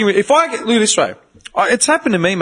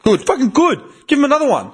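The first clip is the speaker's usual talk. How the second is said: high-pitched, acted endorsement; emphatic approval; 9 semitones higher, faster than usual. Parent backing an uncle's discipline, emphatic acted approval. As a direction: cheer on the punishment, pitched well up.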